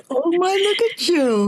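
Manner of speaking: bright, high burst of delight; light up with joy at her news; a high, gushing oh my. Real, not acted. Delighted reaction to client's news.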